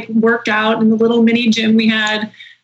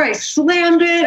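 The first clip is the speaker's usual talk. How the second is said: usual speaking voice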